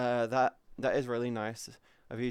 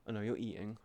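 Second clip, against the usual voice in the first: ordinary talk, nothing marked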